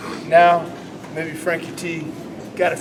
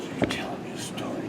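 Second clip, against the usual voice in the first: whisper; 14 dB quieter, faster than usual. Real, not acted. Coach critiques in a hush.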